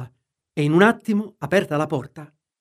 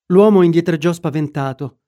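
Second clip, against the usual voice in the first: ordinary delivery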